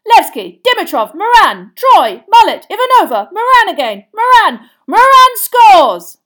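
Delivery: rapid-fire shouted names climbing to a long cry; loud, high play-by-play building to the goal; excited commentator. Acted. Commentator follows the quaffle to a goal, excited shouting. Live Quidditch commentary of passes.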